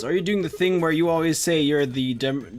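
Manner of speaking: usual speaking voice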